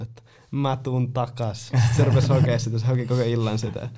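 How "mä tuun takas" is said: put-on slow drunken repeat. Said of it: "Sit se vaan sanoi".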